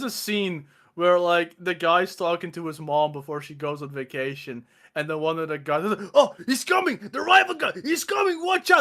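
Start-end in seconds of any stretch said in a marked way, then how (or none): 6.1-8.8 high, loud retold warning cries; imitating a panicked lookout; mock alarm. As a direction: act out shouting a frantic warning. Acted panic in film scene.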